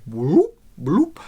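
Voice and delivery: rising whoop then bloop sweep; imitated sine sweep. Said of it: "the sine sweep sound".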